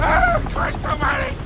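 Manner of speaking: high, terrified cry of alarm; panicked cry. Acted. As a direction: yelp in sheer panic as he's dangled. Gangster dangled over drop.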